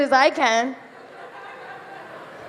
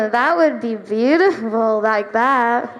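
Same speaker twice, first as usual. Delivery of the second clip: low, slow, drawn out; dreamy admiring; 4 semitones lower, slower than usual. Retold or imitated. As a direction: sigh at the fantasy. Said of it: "And I'm like".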